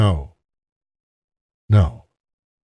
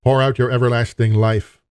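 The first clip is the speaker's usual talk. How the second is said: prayer. Acted. Pastor praying over the congregation, earnest and steady.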